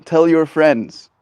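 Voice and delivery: loud, grandiose proclamation slowing to the end; grand savior voice. Acted. Streamer answers the lizard as its grand savior, booming then slow. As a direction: play the mighty saver of lizards, slow and regal. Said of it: "And I go".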